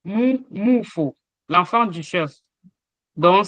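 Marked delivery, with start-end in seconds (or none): 0.0-1.2 mou, moufo said slowly with a falling tone; tone demo. Shown as a demonstration. Teacher contrasts mou and moufo for students. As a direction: draw out mou, moufo and let it fall. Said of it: "C'est le même mou".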